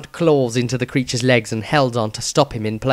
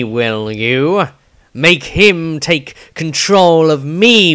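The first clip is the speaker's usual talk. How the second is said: slow, louder voice, set apart from the narration; slow, cold defiance that builds louder on each will you; defiant wizard; 3 semitones higher, 4 dB louder, much slower than usual. Acted. Dieter defies the demon aloud.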